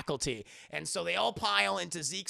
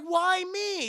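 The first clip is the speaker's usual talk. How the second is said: indignant protest; 12 semitones higher, 7 dB louder than usual. Retold or imitated. Acting out a reluctant character's protest.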